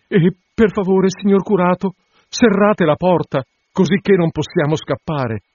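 put-on high, pleading young woman's voice; anxious bride. Bride coaxing the curate to wait, high and pleading.

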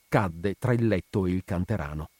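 usual speaking voice

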